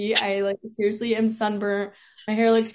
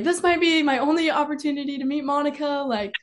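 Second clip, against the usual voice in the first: higher-pitched imitation of a frantic dad; dad freaking out; 6 semitones higher, 4 dB louder than usual. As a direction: play dad desperate, dragging out the last words. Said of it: "he was freaking out".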